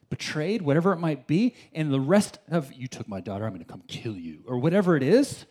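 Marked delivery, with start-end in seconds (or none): 2.7-4.4 low, quiet menacing growl of a threat; revenge movie hero threat. Retold or imitated. Preacher voicing a revenge-movie avenger, low quiet menace. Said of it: "I just think of Liam Neeson".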